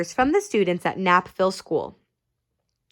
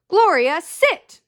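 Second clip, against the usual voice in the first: command; 7 semitones higher, 4 dB louder, slower than usual. Acted. Officer commands the dog.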